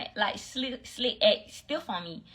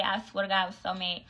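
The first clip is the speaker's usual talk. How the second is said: ordinary talk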